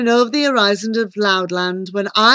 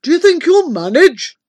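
uncle's questioning voice; 8 semitones higher, 6 dB louder than usual. A narrator's reading. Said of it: "asked my famous uncle".

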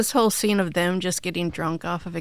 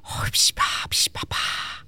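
whispered breathy gibberish; fake foreign language. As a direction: puff out nonsense sounds in a whisper. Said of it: "a lot of heavy breathing".

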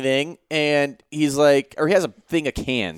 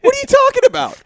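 very high, baffled outburst; incredulous squeal; 4 dB louder, faster than usual. Retold or imitated. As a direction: squeak it in disbelief. Incredulous retort.

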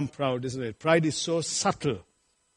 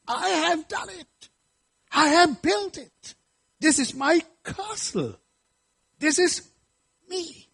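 much higher, puffed-up voice of a boasting king; boastful king; 11 semitones higher than usual. Retold or imitated. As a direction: crow like a proud king admiring his own work. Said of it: "and he said".

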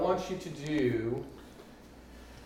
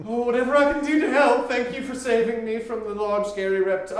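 higher, louder, fast thankful lady voice; grateful doctor; 8 semitones higher, 6 dB louder, faster than usual. Acted. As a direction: gush your thanks, pitched up.

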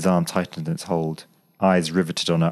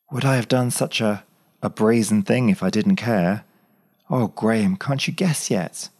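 put-on higher, fervent young woman; Claire, fervent; 5 semitones higher than usual. A narrator's reading. Claire, protesting her love to Graham, fervently. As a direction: let her feelings spill over.

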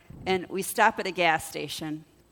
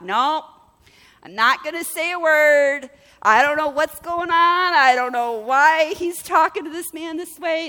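high, loud self-talk, easing off at the end; acted-out, exaggerated determination; emphatic resolve; 7 semitones higher, 7 dB louder than usual. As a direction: insist firmly on staying out of it. Acting out resolving to stay out.

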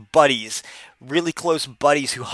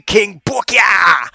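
slow, loud boast, much higher; hype shout; 6 semitones higher, 7 dB louder, much slower than usual. Retold or imitated. Booker T hyping himself up in the hotel suite, loud. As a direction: belt the nickname like a ring entrance. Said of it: "it would go like this".

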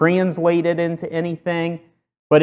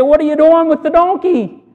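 a higher, indignant owner's cry; protesting donkey owner; 9 semitones higher than usual. Retold or imitated. Donkey owner challenging the disciples, mildly indignant and higher. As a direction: owner objects, pitched up in protest. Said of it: "they say".

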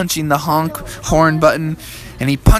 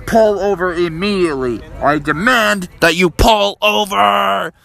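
raised, commanding cop voice; police officer; 5 semitones higher than usual. Acted. Police order the speeding family over, commanding. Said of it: "And the police said".

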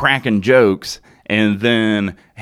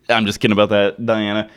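normal talking voice

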